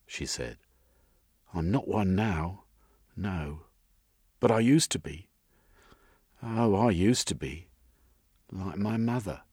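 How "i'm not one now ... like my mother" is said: higher, lilting woman's character voice; wistful young woman character. Acted. Missus confiding her past to Jeremy, higher lilting voice.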